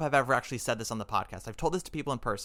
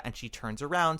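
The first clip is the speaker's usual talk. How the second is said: normal talking voice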